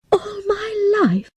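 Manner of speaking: high, stunned cry of disbelief; shocked young girl crying out; disbelieving cry. Acted. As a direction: cry it out, not believing your ears. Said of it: "I couldn't believe it".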